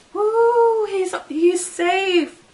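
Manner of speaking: high, loud whoop of relief; cheer, then slow it down; excited whoop. Real, not acted. Cheering that Andy is safe.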